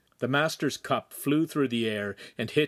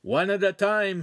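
higher, louder warning; 9 semitones higher, 4 dB louder than usual. A narrator's reading. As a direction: caution them off the ladder. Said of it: "he warned".

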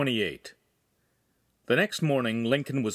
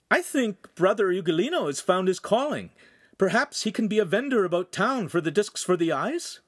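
higher, upbeat character voice; hopeful proposal, pitched up; lightly voiced suggestion; 8 semitones higher than usual. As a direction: put the idea brightly to the table.

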